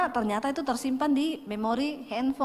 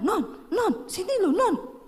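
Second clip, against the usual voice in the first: mock urgent call across the house; husband calling his wife over in a mild mock yell; retold yelling; 7 semitones higher, slower than usual. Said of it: "Dia teriak-teriak".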